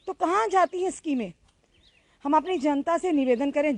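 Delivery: rally the audience to share, with feeling; impassioned news appeal. Acted. Reporter urges viewers to help the family, impassioned.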